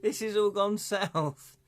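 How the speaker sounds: high-pitched, playful dismay; exasperated protest. Real, not acted. Game master protesting viewer's attack vote, high exasperated dismay. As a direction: plead in a high, incredulous voice.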